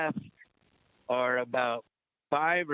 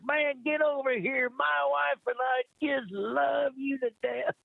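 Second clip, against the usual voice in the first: excited invitation; 11 semitones higher than usual. Retold or imitated. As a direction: mimic a star gushing over a fan, pitched up. [name] voiced in story.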